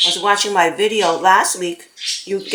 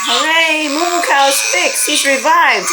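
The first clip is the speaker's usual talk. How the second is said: cheering; 6 semitones higher, 3 dB louder than usual. Real, not acted. Toy fixed, cheering.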